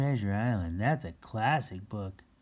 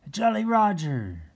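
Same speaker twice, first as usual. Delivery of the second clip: much higher, loud pirate call; pirate cry; 11 semitones higher, 7 dB louder than usual. Shown as a demonstration. Reader calls a pirate word for kids. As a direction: cry it out like spotting the flag.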